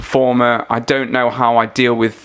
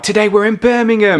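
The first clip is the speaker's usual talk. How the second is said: high-pitched mock presenter announcement; 9 semitones higher, 3 dB louder than usual. Presenter greeting the Birmingham audition crowd, mock announcement. Imitating TV show's location announcement.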